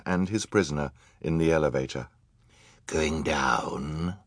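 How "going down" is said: quiet put-on villain question. A narrator's reading. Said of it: "he asked".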